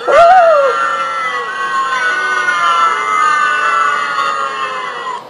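drawn-out high gasp, hammed up; a big mock gasp rising high; long exaggerated gasp. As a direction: let out a long theatrical gasp. Sudden exaggerated reaction on camera.

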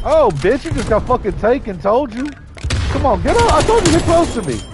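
high, loud challenge shouts; fired-up taunting yell. Real, not acted. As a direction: yell at them to come on. Taunts enemies during a fight.

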